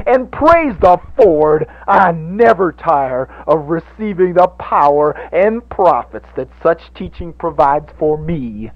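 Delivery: lightly voiced preacher. Preacher's sermon voice.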